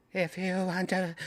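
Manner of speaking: high hammed-up spooky ghost; fake ghost voice. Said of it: "it's like ghost, ghost, fake ghost voice".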